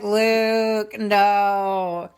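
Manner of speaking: loud, long-held protest; slow, loud, exasperated refusal; mock dismayed scolding. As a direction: draw out each word in disapproval. Host's own reaction to the kiss.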